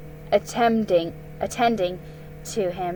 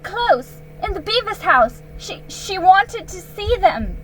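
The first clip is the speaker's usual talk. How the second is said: put-on high, loud boyish voice, rushing; a boy talking fast to please the queen; eager nervous boy; 10 semitones higher, 6 dB louder than usual. Narrator voices Edmund.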